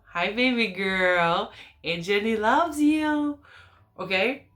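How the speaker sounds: singsong, drawn-out auntie greeting; doting auntie talk. Real, not acted. Aunt greets her little niece through the video, doting singsong. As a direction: coo it slowly, rising high at the end.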